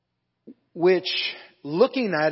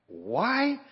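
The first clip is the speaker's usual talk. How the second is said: higher-pitched demanding question; 7 semitones higher than usual. A narrator's reading. Preacher voices Pilate challenging the crowd, demanding. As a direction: read it as a puzzled governor demanding an answer. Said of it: "Pilate demanded".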